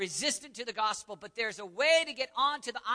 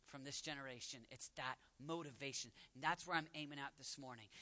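hushed reverence; 7 semitones lower, 15 dB quieter than usual. Real, not acted. Preacher praying the motto over this church, hushed. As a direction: drop to a quiet, prayerful hush. Hushed prayer-like plea after shouting.

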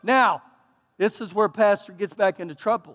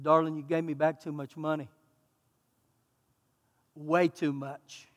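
softly and gently, much softer, slowing on way too much; tender; 6 semitones lower, 8 dB quieter than usual. Retold or imitated. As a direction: break the news kindly. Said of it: "And I said".

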